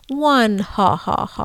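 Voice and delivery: slow, hammed-up counting vampire laugh. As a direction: imitate the vampire who counts. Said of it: "the vampire that counts".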